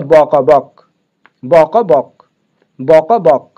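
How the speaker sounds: ব ক বক, the letters spelled one at a time, then joined; slow teacher drill; spelling aloud. Shown as a demonstration. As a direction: sound out each letter, then blend them into বক. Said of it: "এইখানে আমি যখন দিব".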